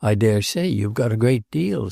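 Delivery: usual speaking voice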